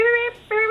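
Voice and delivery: high, piercing electronic beeping; shrill-pitched "beep" imitation; imitated call-button beep. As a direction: mimic a loud alert going off. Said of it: "it's super loud".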